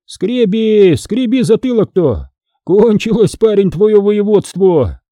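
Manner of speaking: high-pitched taunt; high, drawn-out gloating; snorting mockery. A narrator's reading. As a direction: jeer at the lad's lost command. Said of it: "фыркнул".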